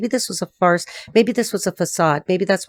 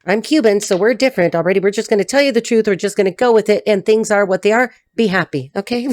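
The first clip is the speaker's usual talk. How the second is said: ordinary talk, nothing marked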